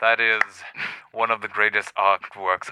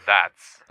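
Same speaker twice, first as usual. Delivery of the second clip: "that's" punched louder; loud, weighted "that's"; stress on THAT'S; 4 semitones lower, 5 dB louder than usual. Shown as a demonstration. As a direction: lean hard on the word "that's". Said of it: "emphasis you put on the word".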